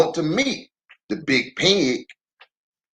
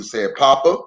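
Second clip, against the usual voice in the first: ordinary delivery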